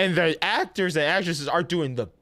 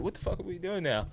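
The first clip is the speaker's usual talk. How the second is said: incredulous mutter; 9 dB quieter, faster than usual. Retold or imitated. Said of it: "And I'm just like".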